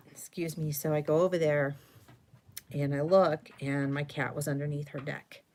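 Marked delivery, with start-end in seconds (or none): none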